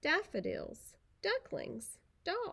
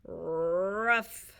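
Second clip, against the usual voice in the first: gruff bark; 4 dB louder than usual. Acted. Gaston barks instead.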